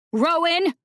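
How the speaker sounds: high loud scream of a name. A narrator's reading. Furious sister calling Rowan downstairs, loud scream. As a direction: shriek the name up the stairs. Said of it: "Celia screamed".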